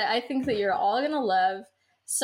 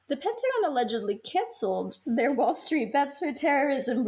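usual speaking voice

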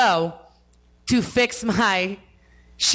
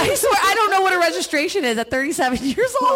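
exasperated outburst; 9 semitones higher than usual. Real, not acted. Exasperated confession to friends.

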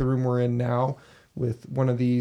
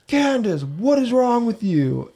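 higher, louder, slowed call after a sister; exasperated call; 10 semitones higher, 6 dB louder than usual. Retold or imitated. Following the figure downstairs, louder exasperated call. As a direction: call her out, fed up, drawing it out.

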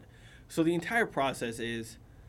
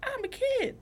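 put-on much higher, cutesy child voice; little kid voice. Imitating the six-year-old girl's cutesy commercial voice. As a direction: squeak it like a small girl. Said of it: "she's doing all of her little".

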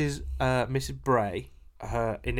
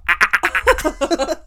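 high cackling ah ah ah, louder; imitated cackle; 13 semitones higher, 11 dB louder, slower than usual. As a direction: mimic a friend's silly cackle. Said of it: "she's got that stupid cackle".

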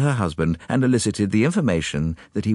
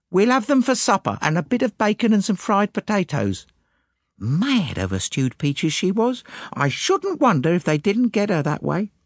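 put-on high servant-girl voice, quoting her friend with relish; gossipy maid, pitched well up; eager maid recollecting. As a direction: recall it proudly and in detail. Annie recalls and quotes Eliza.

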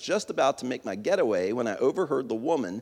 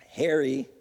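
ordinary delivery

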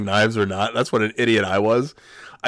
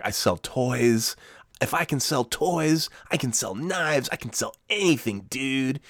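cocky, drawn-out boast with higher pitch; laying it on thick; 5 semitones higher, slower than usual. Retold or imitated. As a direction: oversell yourself, stretching the key words. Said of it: "laying it on real thick".

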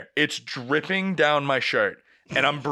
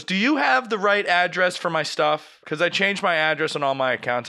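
mock fussy customer; 6 semitones higher than usual. Pharmacy lady voiced in story.

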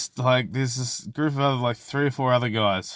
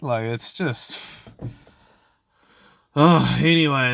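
ordinary talk, nothing marked

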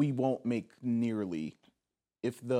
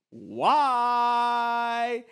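loud, high, held letter Y; long drawn-out call; 11 semitones higher, 8 dB louder than usual. Acted. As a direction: stretch the Y out loud.

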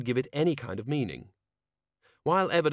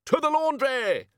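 loud, much higher, slow battle cry; rallying cry; 6 dB louder, slower than usual. A narrator's reading. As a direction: shout it like a charge.

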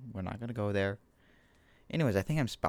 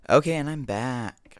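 announcer return; 6 semitones higher, 7 dB louder, slower than usual. Acted. Dramatic return after mute.

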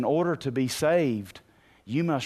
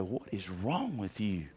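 quiet, understated bewilderment; hushed disbelief; 4 semitones lower, 9 dB quieter than usual. Retold or imitated. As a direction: murmur it like a puzzled outsider. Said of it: "they look at you and go".

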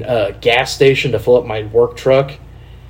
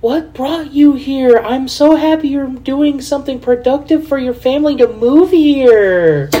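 very high, drawn-out gushing greeting; slow syrupy squeal with bad Spanish; 12 semitones higher, slower than usual.

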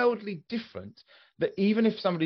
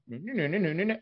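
bobbing Bill and Ben babble; up-and-down lilt; 2 semitones higher, 3 dB quieter, faster than usual. Shown as a demonstration. Showing how Norwegian sounds to them, up-and-down lilt. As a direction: pitch swinging up and down on "No, no, no". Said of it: "it's that up and down".

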